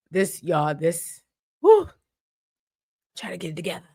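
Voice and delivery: real tears held back, voice falling away; choked up. Real, not acted. To her viewers, overcome by the scene, choked up. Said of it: "I'm about to cry".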